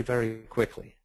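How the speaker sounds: ordinary delivery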